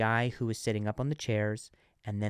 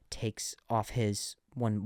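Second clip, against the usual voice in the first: normal talking voice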